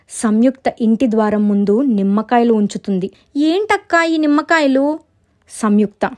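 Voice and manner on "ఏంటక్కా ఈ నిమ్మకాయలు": high, questioning voice; raised, curious lilt; puzzled little sister. Acted. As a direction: ask in acted bewilderment.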